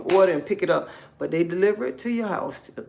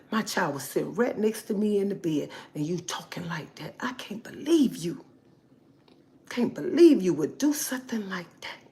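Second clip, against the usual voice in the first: hushed mock outrage; scandalized whisper; 6 dB quieter than usual. Parent writing in about a child hearing it, scandalized whisper. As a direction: whisper a mimicked parent's shocked complaint. Acts out a complaining parent's message.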